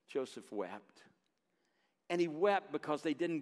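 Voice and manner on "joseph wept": hushed and solemn. A narrator's reading. Solemn telling of Joseph weeping.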